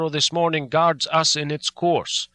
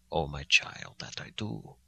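tender; 9 semitones lower, 8 dB quieter than usual. Acted. Old professor consoles Jonathan.

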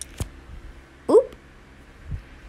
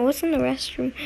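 normal talking voice

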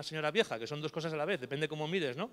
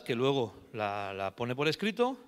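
normal talking voice

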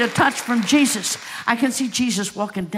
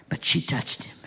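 breathy, hushed "she touched him"; whispered emphasis; 5 semitones lower, 8 dB quieter than usual. Real, not acted. Preacher to congregation, whispering the key line. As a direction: drop to a whisper for effect.

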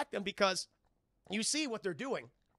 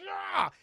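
grunt of effort; 12 semitones higher, 5 dB louder than usual. Retold or imitated. Khan flinging soldier.

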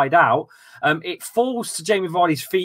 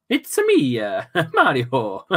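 mock Italian Mario voice; cartoon Italian plumber, lightly done; Italian accent; 4 semitones lower, slower than usual. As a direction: ham up a Mario impression in Italian. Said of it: "in an Italian accent".

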